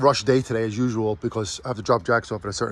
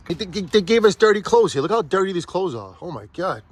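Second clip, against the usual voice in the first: sarcastic; 7 semitones higher than usual. Acted. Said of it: "being sarcastic".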